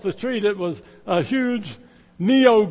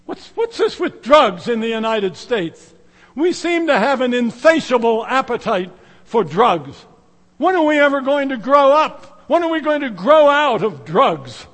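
exasperated citizen; 4 semitones higher, 6 dB louder than usual. Retold or imitated. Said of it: "you hear people say".

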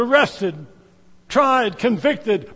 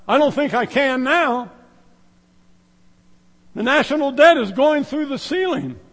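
worried citizen; 6 semitones higher, 6 dB louder than usual. Retold or imitated. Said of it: "What will you hear them say?".

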